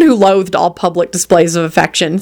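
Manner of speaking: usual speaking voice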